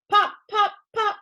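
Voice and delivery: high, loud popping sounds; loud pops. Acted. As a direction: burst out each pop.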